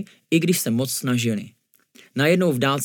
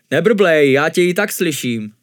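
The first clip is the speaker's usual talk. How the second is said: loud, higher reprimand; scolding; 4 semitones higher, 7 dB louder than usual. A narrator's reading. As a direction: call out the grumbler sharply. Monk scolds the grumbler. (hlasitě, káravě)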